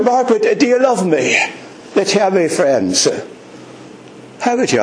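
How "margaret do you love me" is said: higher, earnest question; lightly voiced Lord pleading; the Lord asking. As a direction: ask Margaret as the Lord would. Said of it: "And the Lord was to ask you".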